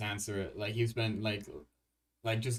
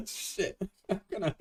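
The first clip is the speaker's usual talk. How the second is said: breaking up into real laughter; self-mocking giggle through the talk; laughing; 9 semitones higher, 4 dB louder than usual. Real, not acted. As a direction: crack up helplessly between the words. Laughs at own cluelessness.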